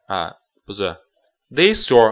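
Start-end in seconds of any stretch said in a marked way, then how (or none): 1.5-2.1 "this your" run together; linking this your. Shown as a demonstration. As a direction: glide "this" into "your". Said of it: "this跟your读". ("this your"连读)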